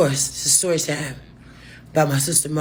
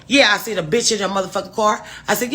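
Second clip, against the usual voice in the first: ordinary talk, nothing marked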